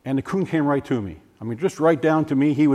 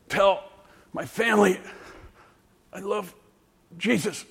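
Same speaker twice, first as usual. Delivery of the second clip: thin, much softer, broken by pauses; barely audible last words; weak dying voice; 5 semitones higher, 5 dB quieter than usual. Retold or imitated. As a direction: push the words out with almost no air. Dying man forces words out.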